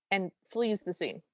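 ordinary talk, nothing marked

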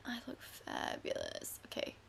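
low breathy whisper. Real, not acted. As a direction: whisper it, pleased. Admiring her look.

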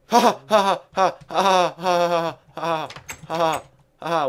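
deliberately hammy staged laugh; fake laugh. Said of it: "I am going to make you laugh".

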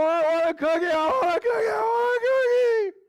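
building from high whine to crying shout; escalating toddler tantrum. Retold or imitated. Toddler screaming for cookies in the aisle, building to a wail. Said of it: "then increases evermore".

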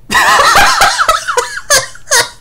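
high-pitched excited cackle; high laugh. Real, not acted. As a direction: burst into shrieking laughter. Laughing at huge bounty.

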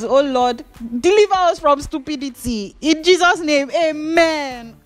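half-joking prayer against stupidity; mock prayer. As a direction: pray dramatically like in church. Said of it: "my prayer continues".

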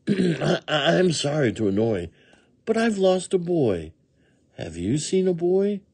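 high puppy voice, politely asking; apologetic puppy. A narrator's reading. Puppy figure in story.

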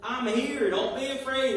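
lightly acted cry across the water; retold calling out. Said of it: "Jesus cries out and says".

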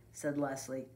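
plain reading voice

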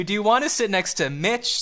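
normal talking voice

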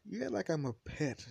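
high-pitched son's protest; offended complaint. Acted. Son complains to mother.

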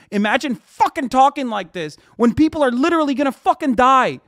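high, loud angry yell; real outrage, voice up and loud; furious shout. Real, not acted. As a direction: explode at the guy in chat. Furious rant at chat user.

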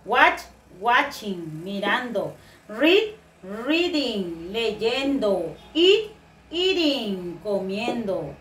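slow modelling. Shown as a demonstration. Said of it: "this verb is necessary to write the I N G".

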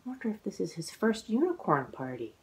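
ordinary delivery, nothing marked

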